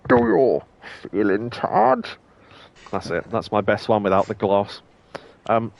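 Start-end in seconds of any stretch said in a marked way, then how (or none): 0.1-2.2 Bane voice. Pretend. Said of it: "I was gonna do a Bane voice".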